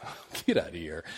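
low, soft scoff of disbelief; sceptical. Retold or imitated. Preacher recalls brushing off the demon talk, sceptical. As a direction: brush it off under your voice. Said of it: "I was very skeptical about this".

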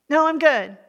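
acted hasty no-thanks; brushing off help. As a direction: wave the offer away at once. Imagined refusal of friend's offer.

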